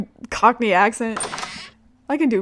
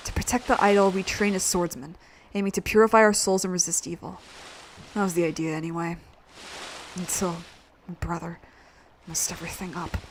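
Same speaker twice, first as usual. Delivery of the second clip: low, quiet, grudging hero voice; sullen reluctant hero; 4 semitones lower, 9 dB quieter than usual. Acted. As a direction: grumble softly at a lower pitch. Ryudo tells of his village.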